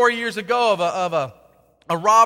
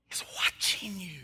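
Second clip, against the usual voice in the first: breathy ominous whisper; whispered threat that God is watching; spooky whisper; 3 semitones lower, 11 dB quieter than usual. Acted. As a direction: hiss it like a warning in the dark. Ominous warning about God watching.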